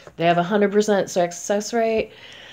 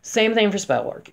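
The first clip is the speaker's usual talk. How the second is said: ordinary delivery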